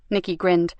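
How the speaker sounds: ordinary delivery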